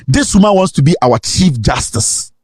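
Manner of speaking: usual speaking voice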